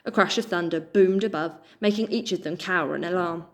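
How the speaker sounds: plain reading voice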